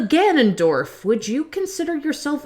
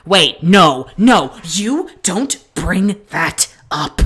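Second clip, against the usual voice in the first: loud, emphatic shutdown; slow, forceful scolding; stern objection; 3 semitones lower, 7 dB louder, much slower than usual. Acted. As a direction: forbid it firmly, drawing out the words. Cuts off a dreaded topic.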